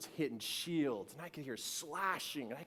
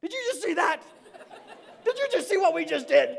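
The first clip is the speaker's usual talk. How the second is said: high, loud, thrilled voice for the dog; acted-out loud, high-pitched amazement; excited dog; 14 dB louder, faster than usual. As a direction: blurt it like an amazed, proud little dog. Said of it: "he's just looking at me like".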